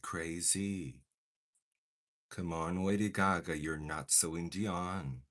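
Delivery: normal talking voice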